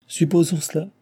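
high, mocking snicker on the words; sneering. A narrator's reading. Engineer mocking Jean's hypothesis, sneering high. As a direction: throw his own words back at him, jeering. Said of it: "ricana l'ingénieur".

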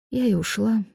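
small, subdued admission; soft, rueful ending; sad. A narrator's reading. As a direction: tell it with quiet resignation. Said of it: "грустно поведала Аполлинария".

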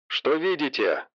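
quiet, distant headset voice; like over a radio headset; through headphones. Acted. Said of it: "раздался в наушниках голос учёного".

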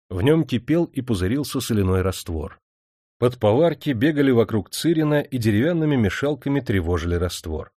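ordinary delivery

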